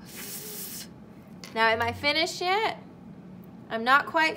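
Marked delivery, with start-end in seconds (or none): 0.0-0.9 voiceless f hissed alone; unvoiced f sound demo; isolated f sound. Shown as a demonstration. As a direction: breathe the f sound. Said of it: "say it really slowly".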